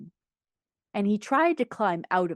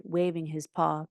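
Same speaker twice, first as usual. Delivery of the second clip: ordinary delivery, nothing marked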